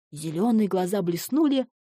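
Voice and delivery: plain reading voice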